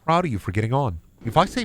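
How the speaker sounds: normal talking voice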